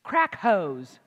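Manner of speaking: "Crack hoes" hit loud after a pause; punchline emphasis. Shown as a demonstration.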